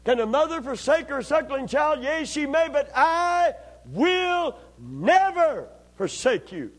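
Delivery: high, loud, drawn-out preacher's cry; preacher's climactic whoop; full-force preaching. Acted. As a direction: stretch "never" into a soaring whoop. Climax of sermon point.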